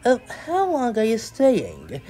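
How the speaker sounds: high, slow, drawn-out question; fussy unease. Acted. Mister Fussy asks his unwanted guest, high uneasy voice. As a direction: ask it with dread, high and prim.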